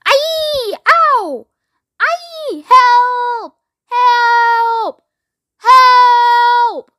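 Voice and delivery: high, loud, terrified yells for help; ghastly screams. A narrator's reading. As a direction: scream as if being attacked. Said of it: "the most ghastly screams and yells".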